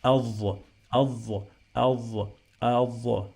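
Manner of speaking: "adh" with the voiced th held long; doubled TH sound. Shown as a demonstration. Teacher models the Arabic word for students, doubled th. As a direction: stress "adh", leaning on a doubled buzzing th. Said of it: "I pronounce this T H another time".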